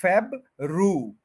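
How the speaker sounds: फेब रू slowly, as two separate parts; R variant. Shown as a demonstration. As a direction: put an r in place of y: फेब रू. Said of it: "वाई की जगह आप आर बोलें". (फेब रू, आर के साथ)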